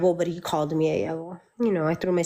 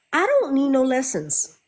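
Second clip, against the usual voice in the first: raised, firm refusal with stress on don't; firm mock refusal; defiant retort; 7 semitones higher than usual. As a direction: say don't need no lessons flatly defiant. First take, firmer.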